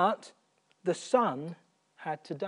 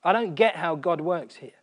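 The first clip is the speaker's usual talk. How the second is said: ordinary talk, nothing marked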